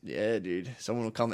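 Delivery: normal talking voice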